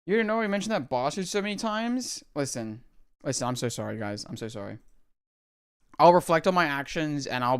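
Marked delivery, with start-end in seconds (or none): 2.4-4.8 low, soft apology; hushed apology. Real, not acted. Streamer apologizing to chat again, hushed. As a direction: say it quietly, almost under breath.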